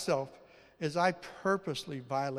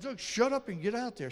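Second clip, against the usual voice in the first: mildly stern order to himself, higher; a touch of bossy self-talk, pitched up; self-command; 7 semitones higher than usual. Retold or imitated. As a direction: tell yourself off and get moving. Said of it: "like, command myself".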